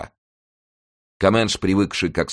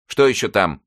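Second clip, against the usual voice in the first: exclaimed; 6 semitones higher, 5 dB louder than usual. A narrator's reading. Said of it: "воскликнул он".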